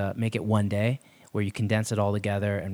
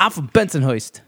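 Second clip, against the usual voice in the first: louder, higher put-on Brooklyn voice; mock Brooklyn accent; 5 semitones higher, 5 dB louder than usual. Cohost showing off his Brooklyn accent, hammed-up. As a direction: brag in a hammed-up Bensonhurst accent. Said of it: "I got that accent down".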